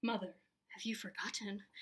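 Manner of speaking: hushed, wounded whisper; dramatic. A narrator's reading. Spencer reproaches his mother, whispering dramatically. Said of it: "Don't be so dramatic".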